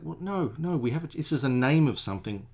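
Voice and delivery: high, loud retold objection; insistent protest. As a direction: protest emphatically, as in an argument. Retold argument with wife.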